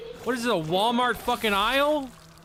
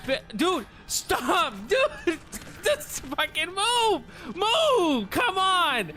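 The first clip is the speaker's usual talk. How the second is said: exasperated shouting; 6 semitones higher, slower than usual. Real, not acted. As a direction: shout him out of your path. Enemy still blocking the way.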